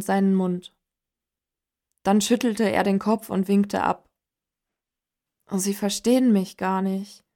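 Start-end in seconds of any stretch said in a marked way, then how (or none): none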